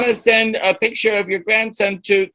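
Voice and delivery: high, lightly voiced first grader explaining patiently; little kid voice. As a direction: play the eager child helper. First grader teaching a senior.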